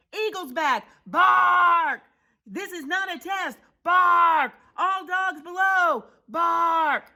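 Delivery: high shouted warning with loud barks; watchdog alarm. Acted. Big Bark warning all dogs of eagle, shouted. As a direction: sound the alarm like a siren.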